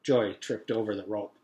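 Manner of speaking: ordinary delivery, nothing marked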